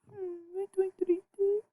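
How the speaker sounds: Estoy triste in a high, fake-weepy whimper; mock crying. Shown as a demonstration. Teacher acts sad for students in a hammed-up cry. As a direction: whimper it like a pretend sob.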